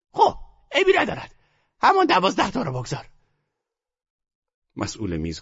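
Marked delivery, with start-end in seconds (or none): 0.1-3.1 put-on much higher, urgent old woman's voice; eager old grandmother. A narrator's reading. Grandmother urging her grandson to bet, eager high voice. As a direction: push him to bet on zero.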